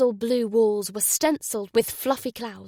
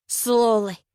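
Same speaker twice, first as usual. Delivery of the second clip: warning; 5 dB louder than usual. Acted. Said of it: "she warned".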